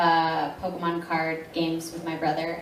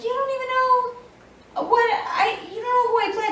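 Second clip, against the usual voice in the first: baffled high squeak; faster than usual. Retold or imitated. Baffled by fans wanting autographs.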